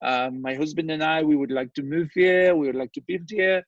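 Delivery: light imitation of a cute Haitian French accent; a touch of Haitian accent, gentle and polite. Landlord imitating a Haitian buyer asking the price, lightly Haitian. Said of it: "cutest little Haitian French accent".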